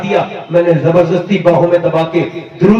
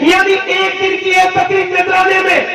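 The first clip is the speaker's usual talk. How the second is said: high, fervent sermon shout; impassioned preaching; 11 semitones higher than usual. Acted. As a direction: preach it out with full force. Preaching at full force. (جوشیلا وعظ، بلند آواز میں)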